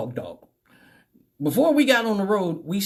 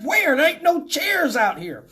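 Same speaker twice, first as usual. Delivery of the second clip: indignant comeback; 8 semitones higher than usual. Acted. Stinger argues back at cop.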